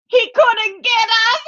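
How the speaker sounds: high, giddy relieved squeal; gloating brother mouse. Acted. Brother mouse realises the cat missed them, high gloating. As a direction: crow it up high after the scare. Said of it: "Hermano goes".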